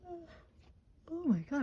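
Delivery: slow, high yawn through words. Real, not acted. Reader pulling charms, yawning mid-sentence. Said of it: "I'm gonna yawn".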